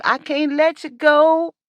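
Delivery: high, drawn-out mock pleading; hammed-up desperate clinging; clingy abusive partner. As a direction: beg like a lover who won't let go. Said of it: "It's like an abusive relationship".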